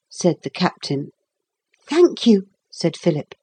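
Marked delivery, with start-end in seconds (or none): 1.9-2.5 put-on high-pitched boy's thanks; grateful boy. A narrator's reading. Boy praised as bold, pleased thanks. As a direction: thank him as a small boy.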